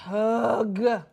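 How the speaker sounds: loud, heavy groan; reluctant groan. Real, not acted. Player not wanting to do this now, groaning. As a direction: groan loudly at the chore.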